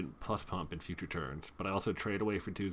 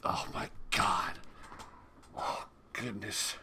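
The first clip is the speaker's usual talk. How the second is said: slow, breathy whisper of exasperation; whispered dismay; slower than usual. Real, not acted. Player cringes at his own joke, slow whispered oh my god. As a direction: sigh the words out under breath.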